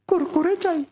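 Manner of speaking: put-on high whiny little boy asking; small boy begging for a snack; child voice. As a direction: pester mom like a small kid. Chhotu tugs mother's sari, demands.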